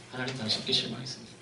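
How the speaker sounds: soft, quick, muttered under the breath; quiet disappointed mutter. Retold or imitated. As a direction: let it slip out quietly, half to yourself. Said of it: "이런 말이 나오는 거예요". (작고 빠르게 혼잣말처럼 중얼거리며)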